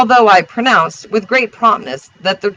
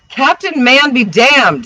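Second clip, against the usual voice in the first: higher, slow, emphatic curse; exasperated outburst; 7 semitones higher, 4 dB louder, slower than usual. A narrator's reading. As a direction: cut him off in exasperation. Narrator's exasperated retort.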